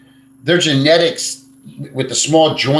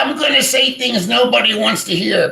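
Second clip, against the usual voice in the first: mocking vlogger quote; 10 semitones higher than usual. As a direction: ham up his dramatic warning. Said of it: "He did a video the other day".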